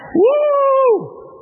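high whooping shout of a cheering crowd. Retold or imitated. Preacher voicing the crowd as they leave Egypt, whooping cheer. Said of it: "and the crowd's cheering".

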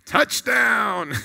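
booming sports announcer call. Shown as a demonstration. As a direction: shout it like a stadium touchdown call. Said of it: "Kinda like the announcer voice".

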